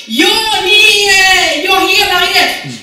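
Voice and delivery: hammed-up long-distance holler, high and loud; shouting back. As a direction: bellow it slowly across a great distance. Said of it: "Ropar han tillbaka".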